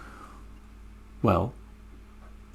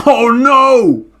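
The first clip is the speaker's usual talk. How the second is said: mock dismay; 9 semitones higher, 4 dB louder than usual. Storyteller's dismay.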